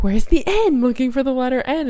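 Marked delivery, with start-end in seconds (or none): 0.0-0.8 imitating co-host. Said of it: "she's like".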